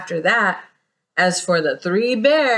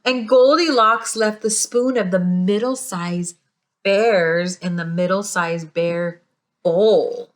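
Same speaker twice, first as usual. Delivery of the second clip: ordinary delivery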